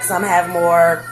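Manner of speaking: ordinary delivery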